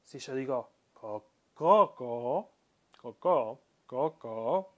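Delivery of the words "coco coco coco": coco with a long first o; drawing out the o in coco; stretched coco. Shown as a demonstration. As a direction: coco said koo-ko. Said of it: "es secundaria, con una estiración distinta".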